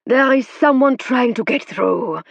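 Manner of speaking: spirit guide speaking through the medium; foreign growl. A narrator's reading. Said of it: "said the spirit guide".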